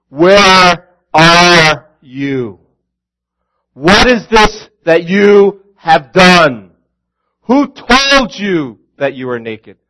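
loud, slow, harsh demand; angry father. Retold or imitated. Preacher imitating an angry father, loud and slow. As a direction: bark it like a fed-up dad. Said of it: "I think of an angry father".

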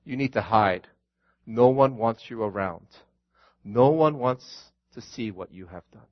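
soft, low accusing murmur; voice of shame. Acted. Preacher voicing shame, low and soft. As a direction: hiss it like shame itself.

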